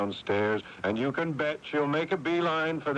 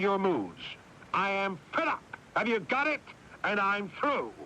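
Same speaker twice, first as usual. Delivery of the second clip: higher, hard angry tirade; furious outburst; 7 semitones higher than usual. Acted. Older brother telling his younger brother he is finished, furious. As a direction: let years of resentment explode.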